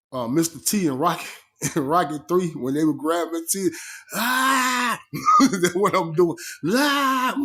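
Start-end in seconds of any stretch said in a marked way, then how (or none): 4.1-5.0 high loud acted roar; raging yell like Mister T held back; mock Mister T scream. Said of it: "I'm screaming". 6.7-7.4 high loud acted roar; raging yell like Mister T held back; mock Mister T scream. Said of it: "I'm screaming".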